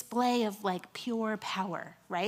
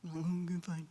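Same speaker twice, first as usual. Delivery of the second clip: a grudging, softer mumbled "fine"; sulking; 4 semitones lower, 5 dB quieter than usual. Retold or imitated. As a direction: sulk like a told-off kid. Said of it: "sulking a little bit about it".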